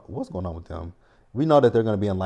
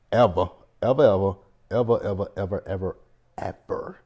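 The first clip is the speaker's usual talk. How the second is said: emphatic repetition; 4 dB louder than usual. Real, not acted. Host refusing to see the couple again, loud then fading. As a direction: start loud on ever and let it die away.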